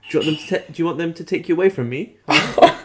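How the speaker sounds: high, fast guilt-trip; guilt-tripping mum. Retold or imitated. A lightly voiced mum guilt-tripping her son. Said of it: "She goes".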